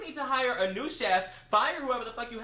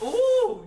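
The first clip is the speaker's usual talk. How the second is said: high loud mocking ooh; 11 semitones higher, 7 dB louder than usual. Mocking the waitress, loud squeal.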